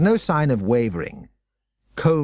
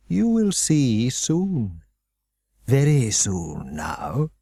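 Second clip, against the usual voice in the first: ominous promise; much slower than usual. Acted. Headmaster promising what's to come, slow and ominous. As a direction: draw out the threat.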